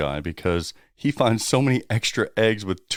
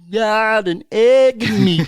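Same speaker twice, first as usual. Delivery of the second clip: high, loud, slow silly voice; cartoon egg voice; 11 semitones higher, 6 dB louder, slower than usual. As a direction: whine it like a hollow toy egg. Voicing an empty egg.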